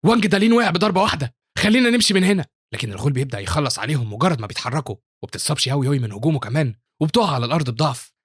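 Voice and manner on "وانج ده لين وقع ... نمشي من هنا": high, loud panicked cry; terrified. A narrator's reading. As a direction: urge everyone to flee in terror. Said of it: "وبيقولوا برعب".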